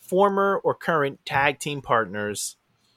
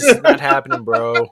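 Vince Russo; 9 dB louder than usual. Retold or imitated. Getting his Vince Russo on, loud mock disbelief. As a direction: exclaim it loudly, falling on the last word. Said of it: "get my Vince Russo on".

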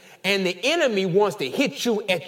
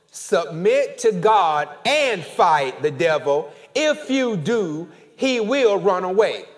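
slow, louder, every word pressed; emphatic reading; 3 semitones higher, 6 dB louder, slower than usual. Shown as a demonstration. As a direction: read it out from the chest. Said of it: "I want you to put some emphasis".

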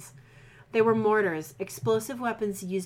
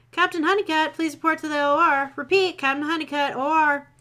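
loud, higher announcement over the speakers; PA announcer; 7 semitones higher, 6 dB louder than usual. Acted. Hospital announcer pages captain over loudspeaker. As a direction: page him over the loudspeaker. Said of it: "it was just an announcer".

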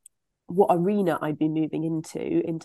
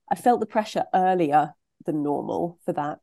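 usual speaking voice

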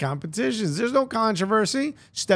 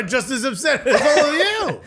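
usual speaking voice

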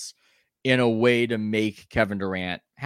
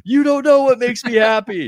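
hammed-up mock yell, much higher and louder; 14 semitones higher, 9 dB louder than usual. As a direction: parody the star's outburst at full volume. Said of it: "they would yell in that tone of voice".

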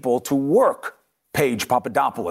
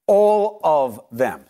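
All drawn out, very slow, falling; stress on all; much slower than usual. Real, not acted. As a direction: land All of them slowly and firmly. Hammering home the witnesses' point.

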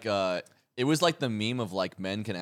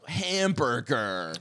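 put-on very slow, drawn-out "Hamburger" in a dumb American accent; mock American saying "Hamburger", slowly; American impression; 3 semitones higher, much slower than usual. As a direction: stretch out "Hamburger" like a dopey American. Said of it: "whenever someone does American".

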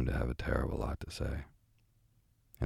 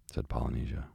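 ordinary delivery, nothing marked

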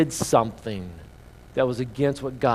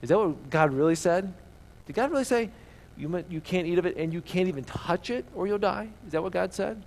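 higher, questioning the quote with raised eyebrows; mock disbelief; 5 semitones higher than usual. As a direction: challenge the words as if doubting them.

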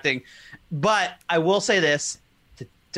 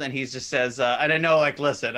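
normal talking voice

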